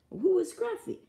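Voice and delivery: loud, high baffled question; startled high query; high confused ask. Retold or imitated. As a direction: demand who Scruffy is. Confused retort to friends.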